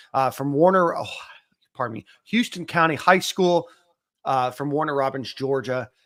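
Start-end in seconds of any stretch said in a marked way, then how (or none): none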